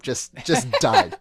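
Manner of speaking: slow words through real laughter; cracking up mid-sentence; laughing. Real, not acted. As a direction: let the laugh break through each word. Laughing at the mullet man.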